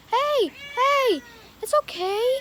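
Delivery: high, loud hushing of a scared kitten; calm the cat down up high; high soothing voice. Real, not acted. Calming an upset kitten.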